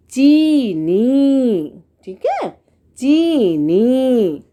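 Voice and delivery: चीनी stretched very slowly, the long ई held; drawn-out teaching demo. Shown as a demonstration. Teacher showing children the long ई in चीनी, very slow. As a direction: draw out every sound of चीनी for the class. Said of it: "teacher drawing out vowel".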